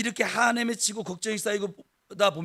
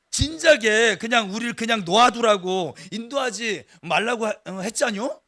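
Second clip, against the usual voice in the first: a Chungcheong-dialect complaint hurled at Moses; 3 semitones higher, 7 dB louder than usual. Retold or imitated. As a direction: play a grumbling crowd in a Chungcheong dialect, half-joking. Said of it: "충청도 출신들이세요".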